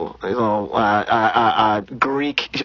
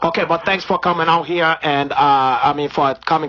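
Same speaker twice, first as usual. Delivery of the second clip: put-on Brazilian accent. Comedian holding a put-on Brazilian accent.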